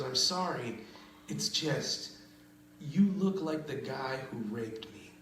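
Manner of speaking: soft, low, halting delivery; quiet imitation with pauses before the blow; hesitant apology. Woman apologizing to the man she rejected, soft and halting.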